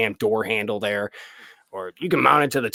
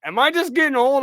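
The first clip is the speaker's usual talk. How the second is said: pitched way up, worried and loud; high mock worry; 7 dB louder than usual. To himself while editing, a high mock panic. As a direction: squeal it like a man panicking about his eyes. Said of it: "I'm like".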